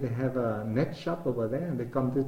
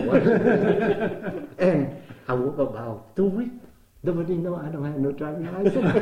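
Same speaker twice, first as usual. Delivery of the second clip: laughing; 5 semitones higher, 7 dB louder than usual. Real, not acted. Laughs about having no license.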